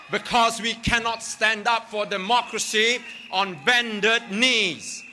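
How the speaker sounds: rally speech building to a shout; rising from firm to roaring; urgent activist. Acted.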